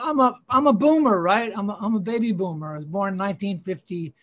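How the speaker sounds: ordinary talk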